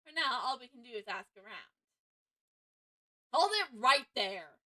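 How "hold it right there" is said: shouted objection. Acted. Game objection callout.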